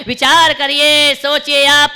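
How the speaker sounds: loud, slow, drawn-out exhortation; full-force preaching. Real, not acted. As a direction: call on the crowd to reflect.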